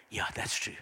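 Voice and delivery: very soft, conceding reply; hushed admission. Acted. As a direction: quietly admit it to yourself. Imagined soft reply to Spirit.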